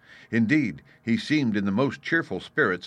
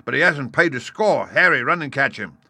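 high, loud landlord's order; crying out; 6 semitones higher, 7 dB louder than usual. A narrator's reading. Landlord sends Harry after a non-payer, crying out. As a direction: shout orders across the tavern. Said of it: "cried Silver".

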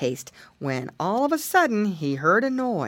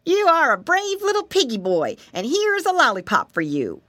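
higher, praising storekeeper voice; kindly storeman; 6 semitones higher, 4 dB louder than usual. A narrator's reading. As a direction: praise a small child, pitched up at first. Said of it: "the storeman said".

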